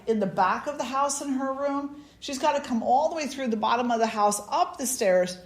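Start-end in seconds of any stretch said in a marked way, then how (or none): none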